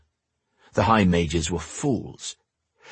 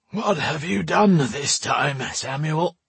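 put-on high, slow, drowsy old man's voice; lightly voiced old man, just woken; sleepy old man; 8 semitones higher, slower than usual. A narrator's reading. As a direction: draw it out sleepily, pitched high. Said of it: "he asked in a sleepy voice".

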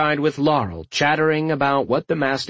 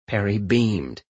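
usual speaking voice

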